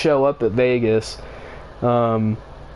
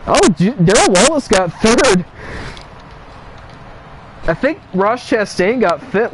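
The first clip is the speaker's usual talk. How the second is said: excited; 7 semitones higher than usual. Real, not acted. Surprised at race results.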